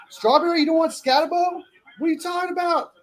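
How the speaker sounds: high incredulous teasing; jokingly outraged high voice; mock outrage. Real, not acted. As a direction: squeal it in disbelief at your friend. Teasing a friend over a pick.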